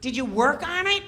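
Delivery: high, loud whining needle; whiny Kattan. Retold or imitated. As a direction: nag him in a high whine. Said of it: "Kattan's going".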